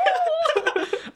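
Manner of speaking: squealing high laughter; high-pitched laugh. Real, not acted.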